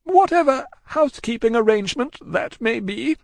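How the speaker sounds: high woman's character voice; woman composing herself. A narrator's reading. Miss Pross answering Cruncher, striving to compose herself. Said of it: "striving to dry her eyes and compose herself".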